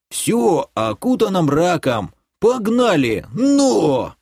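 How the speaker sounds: высокие протяжные выкрики старика; погоняй коня задорно, голос вверх; старик погоняет скакуна. Acted. (понукает высоким стариковским голосом)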